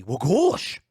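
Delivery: hammed-up country "gosh", swooping up at the end; cartoonish mock country accent; goofy country accent. Shown as a demonstration. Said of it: "I think it's the goofy".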